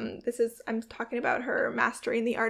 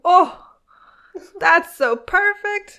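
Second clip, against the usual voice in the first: loud, high swoon; high-pitched, delighted gushing; 7 semitones higher, 11 dB louder, slower than usual. Real, not acted. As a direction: melt over a love line, drawing it out. Swooning over a love quote.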